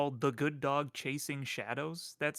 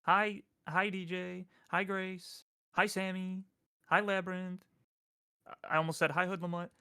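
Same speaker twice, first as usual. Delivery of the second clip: higher, bouncy cartoon hellos; chirpy high hellos to each chatter; SpongeBob-style greetings; 6 semitones higher than usual. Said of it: "I'm gonna do the SpongeBob thing".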